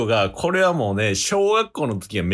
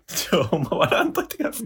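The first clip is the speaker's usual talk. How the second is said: laughing plea; 6 semitones higher than usual. Real, not acted. Begging not to laugh.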